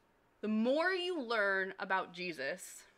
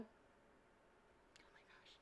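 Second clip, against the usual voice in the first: barely breathed, very slow and soft; slow awed whisper; 33 dB quieter, much slower than usual. Real, not acted. Whispering to the audience, moved almost to tears. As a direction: let it slip out, almost silent.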